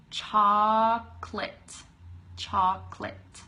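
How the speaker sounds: chocolate as CHOC-lit, slow and drawn out; chocolate slow, with the middle vowel dropped; two-syllable chocolate. Shown as a demonstration. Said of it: "we won't talk about the other meaning".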